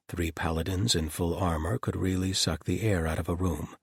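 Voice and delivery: ordinary delivery, nothing marked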